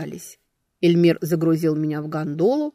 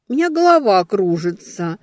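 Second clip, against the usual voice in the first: moaning complaint; 3 semitones higher, 7 dB louder than usual. A narrator's reading. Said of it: "простонала я".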